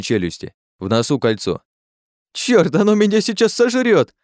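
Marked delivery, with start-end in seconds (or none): 2.3-4.1 panicked cry. Acted. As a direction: yelp in panic as it charges. Terrified at the monster.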